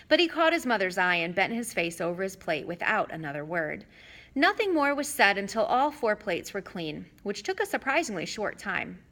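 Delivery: plain reading voice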